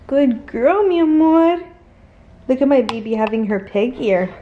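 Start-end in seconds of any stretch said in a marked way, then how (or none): 0.1-1.6 higher, louder doting praise. Real, not acted. Owner praising her dog in doting baby talk. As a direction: gush over a good dog.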